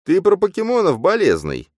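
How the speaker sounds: very high, loud, incredulous voice; high-pitched stunned sidekick; shocked phantom. A narrator's reading. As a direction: squeal in disbelief, then drop. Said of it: "протянул фантом".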